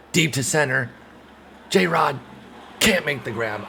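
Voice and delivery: high, loud rising alarm; excited call. Real, not acted. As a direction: call the fly ball in rising panic. Deep fly ball, tense call.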